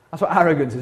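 usual speaking voice

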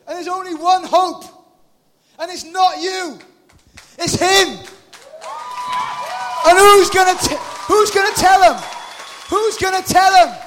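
shouted, high and loud; shouting. Acted. Preacher challenges congregation, shouting high. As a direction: hammer it home at full volume.

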